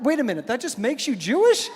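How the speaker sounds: high, mock-baffled double take; incredulous question. Husband working out his wife's heritage, incredulous. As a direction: rise into comic disbelief.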